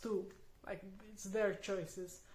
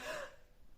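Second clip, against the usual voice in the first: a sharp breathless gasp; quick frightened intake of breath; startled gasp; 8 dB quieter than usual. Retold or imitated. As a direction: gasp like a scared child. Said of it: "be like".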